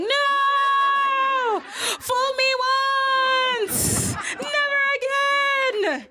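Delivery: very high, slow wailing shout; ancestors' warning. Retold or imitated. Mekatilili and the ancestors warning her, very high wailing cry. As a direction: wail it like outraged ancestors from beyond. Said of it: "the ancestors going".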